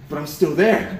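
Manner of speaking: ordinary delivery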